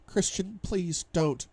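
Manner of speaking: high-pitched, pained plea; pleading. A narrator's reading. Wife begs husband not to, pleading. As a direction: beg him in a small high voice.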